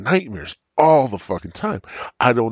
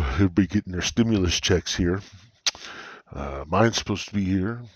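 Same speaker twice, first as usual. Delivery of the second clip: usual speaking voice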